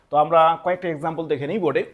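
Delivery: ordinary talk, nothing marked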